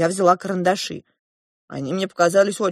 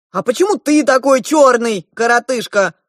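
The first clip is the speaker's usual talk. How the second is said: high, loud, taunting retort; exasperated; 7 semitones higher, 8 dB louder than usual. A narrator's reading. The elder prince turns on the dwarf, exasperated. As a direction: fling the question back loudly. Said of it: "вдруг не выдержал старший принц".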